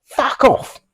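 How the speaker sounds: high squawky parrot; high parrot squawk. Retold or imitated. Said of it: "And he went".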